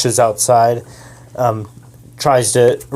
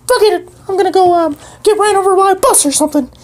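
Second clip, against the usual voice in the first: high fast bird voice; squeaky bird voice; faster than usual. Acted. Bird PD refuses help, high fast squeaky voice. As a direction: chirp it out fast.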